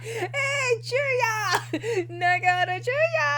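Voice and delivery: high, laughing mock yell across the room; Dazai calling out. Dazai shouting for Chuya in front of the sheep, laughing mock call. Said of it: "classic fucking Dazai just be like".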